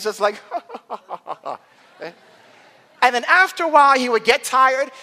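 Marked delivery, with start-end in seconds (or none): 0.5-1.5 imitated mocking laugh. Older brother holding off the younger one, imitated mocking laugh. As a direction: chuckle like an untroubled big brother. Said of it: "and he's laughing".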